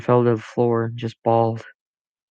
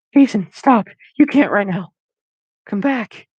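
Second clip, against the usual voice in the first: much higher, louder desperate call; 10 semitones higher, 5 dB louder than usual. A narrator's reading. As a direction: shout after him as he runs. Calling after him.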